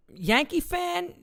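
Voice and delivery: drawn-out high incredulous question; mock surprise. Streamer reacting to chat, slow high mock disbelief.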